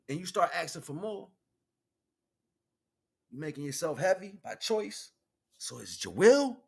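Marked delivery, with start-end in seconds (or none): none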